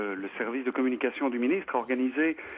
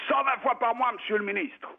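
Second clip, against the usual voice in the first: para at attention, pitched way up; soldier answering; 9 semitones higher than usual. Retold or imitated.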